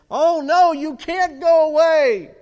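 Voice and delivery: high, loud panicked cry; mock-panicked wail, high and loud; panicked disciples. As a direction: plead in mock alarm as frightened disciples. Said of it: "And they panicked".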